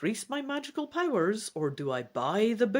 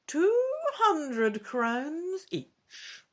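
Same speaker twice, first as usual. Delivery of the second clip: put-on high, slow old woman's voice; high old fortune-teller voice; old shopkeeper woman; 10 semitones higher, much slower than usual. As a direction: draw the price out like a canny old merchant. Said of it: "Madame Ursula answers".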